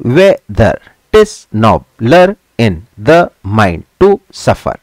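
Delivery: WHE-THER, TIS, NO-BLER, each beat separated; slow, measured syllable-by-syllable "whether tis nobler"; iambic scansion. Shown as a demonstration. Teacher scanning the next line for students, in measured iambic beats. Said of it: "इसी तरह से नीचे".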